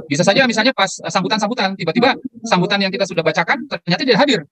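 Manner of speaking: ordinary talk, nothing marked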